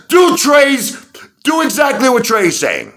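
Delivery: loud, high dare; challenging shout. Real, not acted. Daring critics to follow Trey's advice, high loud shout. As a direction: throw the challenge right in their faces.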